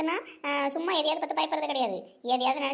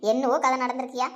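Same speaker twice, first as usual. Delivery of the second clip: usual speaking voice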